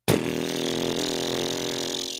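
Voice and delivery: loose, low buzz through the lips. Shown as a demonstration. Instructor showing how to play didgeridoo, lips buzzing low. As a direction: blow a long, loose lip buzz. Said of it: "loosely buzz your lips like this".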